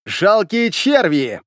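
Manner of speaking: slow, drawn-out, high contempt; ringing female voice. A narrator's reading. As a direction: spit the insult out slowly from above. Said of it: "Донёсся звонкий женский голос".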